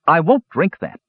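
high protesting refusal; refusing. A narrator's reading. Palin refusing the dwarf's drink, protesting. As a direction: balk at it, pitched high.

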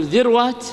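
ذروات said dhirwāt, no vowel after the r; vowelless middle consonant. Shown as a demonstration. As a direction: run r straight into w in ذروات. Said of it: "إما التسكين أو الفتح". (ذروات بتسكين الراء)

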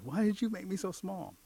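high-pitched small-person complaint; lightly acted tiny warrior; shrunken warrior. Shrunken warrior questions the mole.

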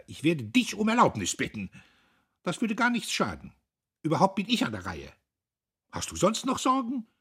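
high, loud sisterly bickering; sharp. A narrator's reading. Sisters squabble over who may court, sharp-voiced. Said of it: "mit scharfen Stimmen sprechen".

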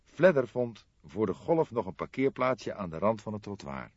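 ordinary delivery

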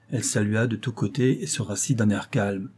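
plain reading voice

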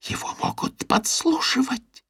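hushed conspiratorial whisper; mock-dramatic whisper; tragic whisper. A narrator's reading. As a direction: whisper it like a grave secret. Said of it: "трагическим шёпотом".

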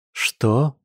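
mildly stunned question; astonished. A narrator's reading. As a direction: ask as if caught off guard. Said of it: "изумлённо замерла она". (изумлённо)

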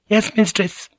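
put-on very high, obedient girl's voice; high-pitched dutiful reply; young maid. A narrator's reading. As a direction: answer like a little servant girl. Voices child maid answering.

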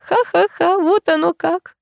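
high mocking cackle; bitter laugh. A narrator's reading.

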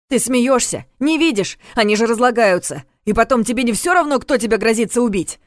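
high, loud, exasperated outburst; heated retort. A narrator's reading. Vanya argues with hesitant Voytakh about shooting, heatedly.

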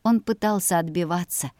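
ordinary delivery, nothing marked

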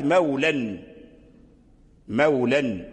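short last vowel closed by n in "مولا"; tanween ending. Shown as a demonstration. Teacher showing the alif dropped, "مولا" ending in "-an". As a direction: say "مولا" as "mawlan". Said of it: "فحذف الألف للتنوين".

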